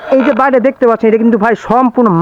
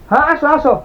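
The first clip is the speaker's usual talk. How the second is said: higher-pitched call to come over; calling someone over; 6 semitones higher than usual. Real, not acted. Calling to the cousin to come this way, pitched up. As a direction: beckon him from a distance. Waving brother over from afar.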